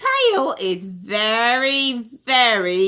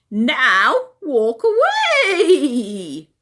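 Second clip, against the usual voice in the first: slow, louder drawn-out order with a laugh; big brother pig teasing; hammed-up slow bossy pig; pig bossing; 3 semitones higher, 5 dB louder, much slower than usual. Henry stamps on tail.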